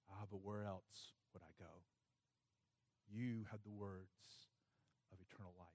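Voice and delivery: very soft, devout musing with pauses; reverent reflection. Retold or imitated. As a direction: murmur it prayerfully, phrase by phrase. Recalls his own devout thought.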